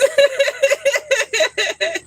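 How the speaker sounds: a sudden high-pitched squeal of laughter. Real, not acted. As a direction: burst out in a high, shrieking laugh. Laughs at a viewer's comment.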